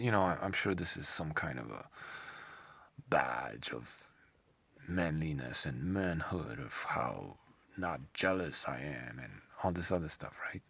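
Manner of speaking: usual speaking voice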